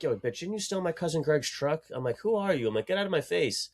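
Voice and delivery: high, scornful retort; confronting the girl. Retold or imitated. Retelling his confrontation with the girl, high and scornful. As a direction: snap back at her. Retold confrontation with truck thief.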